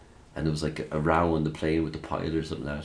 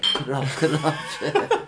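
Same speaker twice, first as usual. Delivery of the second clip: laughing manager; 8 semitones higher, slower than usual. Retold or imitated. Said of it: "his manager's like".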